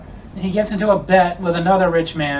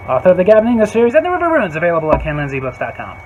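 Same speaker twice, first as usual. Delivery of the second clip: fast ad read; 4 dB louder, faster than usual. Acted.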